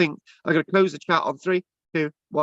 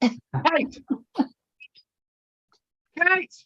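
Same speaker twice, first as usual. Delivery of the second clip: calling out for Kate, rising; 8 semitones higher than usual. Real, not acted. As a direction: call her name up high. Calling out for a caller who vanished.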